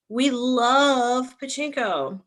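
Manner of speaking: drawn-out gushing. Real, not acted. Host gushing to book club about the novel, drawn-out singsong. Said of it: "I don't know why I'm s-, saying it like that".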